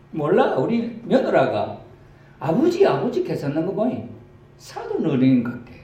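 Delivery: put-on a country old woman talking about her daughter-in-law; Gyeongsang grandma. Grandma guesses Jesus is the in-law, in high Gyeongsang dialect. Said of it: "경상도 버전으로".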